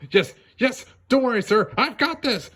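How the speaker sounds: high, bright bunny voice, reassuring; eager Judy. Acted. Judy reassuring the merchant she's on it, high eager voice. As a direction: promise it like a keen rookie cop. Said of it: "said Judy".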